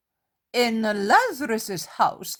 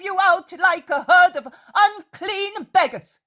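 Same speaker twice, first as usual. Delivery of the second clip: loud, much higher, furious commands; wrathful; 8 semitones higher, 6 dB louder than usual. A narrator's reading. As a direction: drive them out of the house in fury. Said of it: "She is imposing in her wrath".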